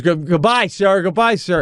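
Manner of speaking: high, insistent send-off; dismissive coach. Retold or imitated. Coach seeing off an ejected dad, high dismissive farewell.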